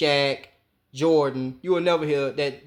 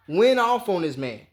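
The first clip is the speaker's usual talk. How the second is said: ordinary talk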